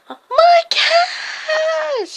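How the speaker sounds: very high drawn-out screech; screaming old lady. Acted. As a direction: wail for lost cats at full pitch. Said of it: "She starts screaming".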